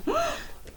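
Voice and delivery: high startled gasp; quick intake of breath; sharp gasp. A narrator's reading.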